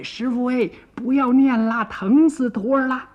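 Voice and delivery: 高声喊疼讨饶; 拔高嗓门，痛苦地求师傅别念; 疼痛哀求. A narrator's reading. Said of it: "疼得孙悟空也打不了了". (疼得高声哀叫求饶)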